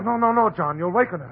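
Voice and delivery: high, urgent interruption; alarmed protest. Acted. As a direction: urgently try to stop him. Doctor restrains panicking husband.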